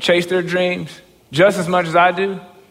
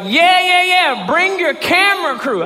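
gushing retold welcome, pitched way up; eager agreement; 11 semitones higher than usual. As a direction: imitate an eager team saying yes. Team eagerly welcomes him, retold.

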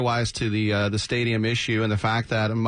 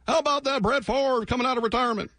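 much higher put-on voice; mock small-town radio host; 12 semitones higher than usual. Imagined Alabama drive-time host, high mock radio voice. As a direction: pitch it way up like a hokey host.

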